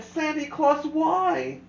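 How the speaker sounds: put-on high, small child's voice, pleading; a tiny girl asking why, near tears; a small Who child questioning the Grinch, high and teary; little girl voice. A narrator's reading. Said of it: "She stared at the Grinch and said".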